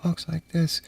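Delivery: mimicking the soft-spoken support agent on the line; faint mumbled imitation. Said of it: "Try talking technical to some dude in China who".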